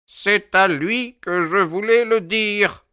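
high, slow, dug-in insistence; high-pitched stubborn elder; obstinate old man. A narrator's reading. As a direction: repeat it stubbornly in an old sailor's voice. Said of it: "répéta-t-il obstiné".

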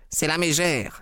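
sudden high outcry; exclaimed. Acted. Husband spotting a figure at the window, high sharp exclamation. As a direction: blurt it out, pitch jumping up. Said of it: "s'écria Grant Munro".

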